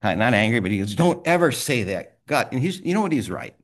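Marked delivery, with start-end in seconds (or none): 1.0-2.2 firm rebuke. Retold or imitated. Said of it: "and he got angry. Not angry".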